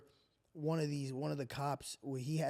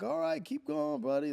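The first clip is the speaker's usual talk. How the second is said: high-pitched coaxing cheer; imitating a buddy egging someone on, high; mock encouragement; 11 semitones higher than usual. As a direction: coax him along in a high voice. Cops cheer on rookie doing CPR.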